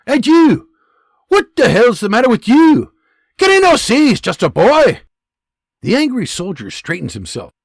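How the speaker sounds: loud, high Scottish dressing-down; Scottish sergeant tirade. Acted. Scottish sergeant berating his angry private. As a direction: tear strips off him, Scottish.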